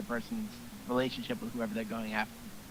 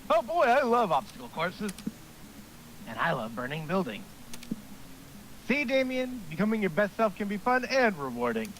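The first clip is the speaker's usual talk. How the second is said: higher, gung-ho coach; cheery overexcited gym coach; eager coach voice; 8 semitones higher than usual. Acted. Coach voice continues.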